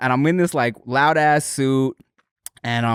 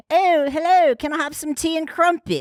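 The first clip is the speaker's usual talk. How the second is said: higher, hammed-up posh English voice; posh British accent; 10 semitones higher than usual. Mocking how Americans hear Brits, in a hammed-up posh British accent. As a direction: play the tea-and-crumpets Englishman. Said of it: "English accents".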